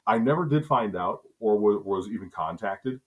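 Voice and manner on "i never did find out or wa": ordinary delivery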